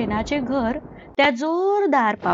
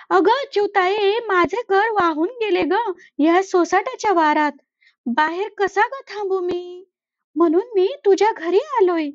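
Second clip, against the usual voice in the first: lightly higher, sorry-for-himself crow; sad crow; 6 semitones higher than usual. Acted. Crow tells the sparrow his house washed away, lightly plaintive. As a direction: tell your woe. Crow explains lost home.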